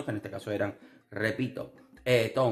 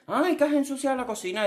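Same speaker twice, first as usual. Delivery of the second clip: high, fussy complaining voice; whiny boyfriend; 12 semitones higher than usual. Retold or imitated. Imitating boyfriend nagging about the dirty kitchen, whiny. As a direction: nag like a fussy partner. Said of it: "mi novio que va y dice".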